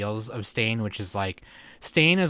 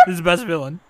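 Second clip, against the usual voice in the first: laughing; 6 semitones higher, 3 dB louder, faster than usual. Real, not acted. Joking, laughing.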